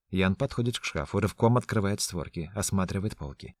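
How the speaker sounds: usual speaking voice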